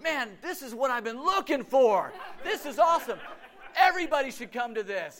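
high-pitched, hammed-up delight; staged enthusiasm. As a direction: gush so others overhear. Staged enthusiasm for stall listeners.

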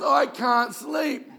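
slow, loud, high, laughing through it; laughing quote. Retold or imitated. Pastor retelling a landlord's late call, laughing loudly. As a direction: laugh out the old man's complaint. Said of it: "He says".

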